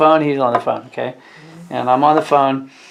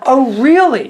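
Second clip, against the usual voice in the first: mock surprise; 10 semitones higher, 5 dB louder than usual. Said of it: "And I'm like".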